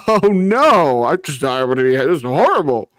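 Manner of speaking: high, hammed-up fake horror; mock dismay. Banished man pretending his punishment is horrible, mock dismay. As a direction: lament it with pretend distress.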